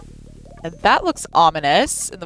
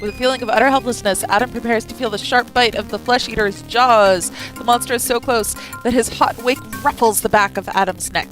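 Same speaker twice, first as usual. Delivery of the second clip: fast, higher dramatic narration; 4 semitones higher, 4 dB louder than usual. A narrator's reading. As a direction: build the tension. Reads game narration.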